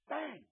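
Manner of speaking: high shouted bang like a knock; sharp bang. Acted. Preacher marking Jesus' knock on the disciples, sharp bang. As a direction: strike it like a knock on a door.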